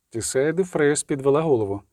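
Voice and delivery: ordinary delivery, nothing marked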